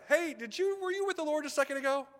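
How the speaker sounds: high, fast, eager questioning; excited bystanders. Acted. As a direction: pepper her with eager questions. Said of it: "And they're like".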